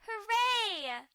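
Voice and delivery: high, loud hooray; cheer. Acted. Kids arriving at Nana's house, cheering. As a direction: shout for joy.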